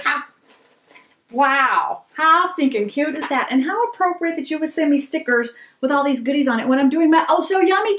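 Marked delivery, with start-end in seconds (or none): none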